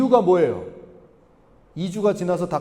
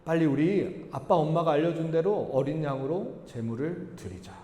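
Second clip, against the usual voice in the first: lightly voiced brother, gently urging in a lowered tone; quiet, measured appeal to an older brother; younger brother's appeal; 6 semitones lower, 10 dB quieter than usual. Preacher voices Abel urging his brother.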